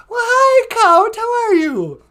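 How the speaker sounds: very high, sugary hostess voice; sweet greeting. Acted. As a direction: coo a hello way up high. Co-host greets caller sweetly.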